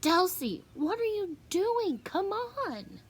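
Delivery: high, impatient calling; yell. A narrator's reading. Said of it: "Brandy yells again".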